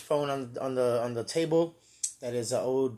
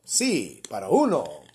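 phone menu voice; 6 semitones lower, slower than usual. Pretend. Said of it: "For Spanish, press one".

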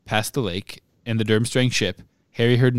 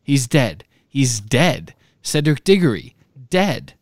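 a crowd's rising cry, louder and higher toward the end; shouted; 3 semitones higher, 4 dB louder, slower than usual. A narrator's reading. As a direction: call the news out in shock. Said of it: "others shouted it".

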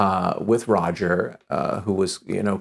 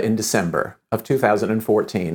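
ordinary talk, nothing marked

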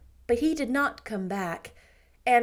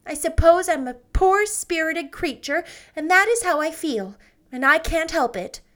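lightly voiced shy woman, pitched up; a hint of a meek older woman confiding; Janet, timid spinster; 6 semitones higher, 4 dB louder than usual. A narrator's reading. Janet answers, resigned.

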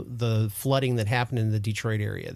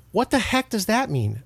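puzzled; 8 semitones higher than usual. Retold or imitated. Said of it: "she was like".

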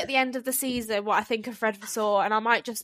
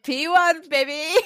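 mock triumphant radio call; 6 semitones higher, slower than usual. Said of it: "And he was like".

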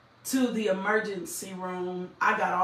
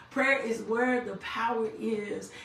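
normal talking voice

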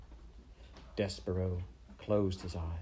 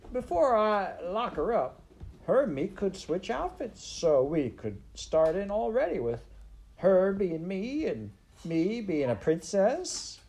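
put-on very high, loud, eager serving girl; Midge; 11 semitones higher, 4 dB louder than usual. A narrator's reading. Serving girl wanting to be princess, high eager voice. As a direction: pipe up loudly in a high, simple voice. Voicing Midge proposing a swap.

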